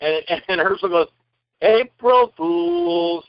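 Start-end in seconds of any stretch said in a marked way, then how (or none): 1.6-3.2 high, very slow singsong; drawn-out prank reveal. Retold or imitated. Director reveals prank to actor, high drawn-out announcement. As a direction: stretch it out high, savouring the reveal.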